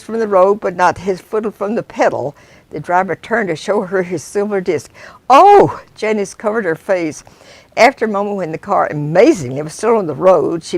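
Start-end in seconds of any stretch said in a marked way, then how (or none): 5.3-5.8 loud high cry of alarm; frightened gasp. Acted. Passenger crying out as the driver looks away, loud fright. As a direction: gasp in terror.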